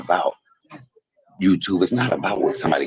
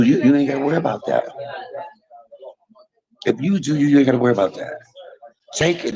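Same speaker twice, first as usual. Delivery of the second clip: normal talking voice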